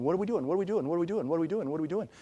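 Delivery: mock panic, rapid-fire repetition; hammed-up fretting on a running loop; freaking out. People doubting God's control, mock freaking out. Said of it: "we're freaking out here going".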